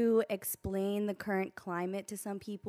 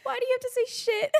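laughing; 4 dB louder, faster than usual. Real, not acted. Laughing protest at friend.

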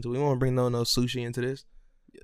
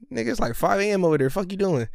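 retold indignant disbelief; 7 semitones higher than usual. Retold indignation at dad's late call.

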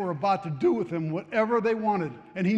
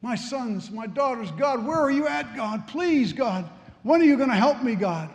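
crying out to God; 7 semitones higher than usual. Retold or imitated. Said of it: "She started crying out to God".

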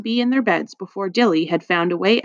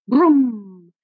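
drawn-out vroom; voiced "vroom" of an accelerating ambulance; engine roar; 3 semitones higher, 4 dB louder than usual. A narrator's reading. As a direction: imitate an engine revving away. Narrator voices the engine revving.

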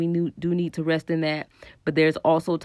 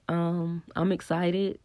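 normal talking voice

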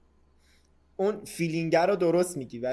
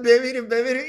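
high, loud mock chant of the praying crowd; mock prayer; 10 semitones higher, 10 dB louder than usual. Mocking the praying crowd for a podcast co-host, high and loud. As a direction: ham up their praying, much higher and louder.

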